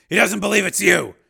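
high, loud, forceful statement; loud urging. Acted. Steele tells captive his son doubts him, loud. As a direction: raise your voice to press the prisoner.

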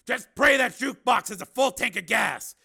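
high, loud, businesslike orders; Steele. Acted. Steele telling Raven to wait behind, loud and commanding. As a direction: project pitched well up, taking charge.

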